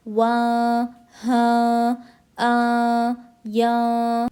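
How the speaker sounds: "अ, ब, त" each stretched into a held nasal sound; nasal ikhfa. Shown as a demonstration. Teacher drilling ikhfa, each syllable nasalised and drawn out. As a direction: hide the n in the nose on "क", tongue touching neither top nor bottom. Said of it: "हम टंग को middle में रख कर पढ़ेंगे".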